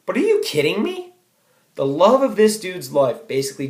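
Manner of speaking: can't believe what he just read; disbelief. Real, not acted. Student fuming at the novel's ending, loud and incredulous. Narrator's own outrage at the book.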